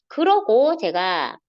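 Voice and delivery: ordinary talk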